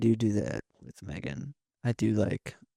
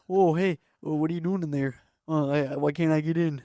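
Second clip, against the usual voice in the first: mock Canadian dog voice; 5 semitones higher than usual. Showing his Canadian dog voice to the cohost, high and hammed-up Canadian accent. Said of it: "I make him sound Canadian".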